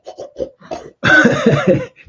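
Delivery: high airy bulldog snuffle; English bulldog snort. Retold or imitated. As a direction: snort like a squashed-nose bulldog. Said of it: "that's my impression of an English bulldog".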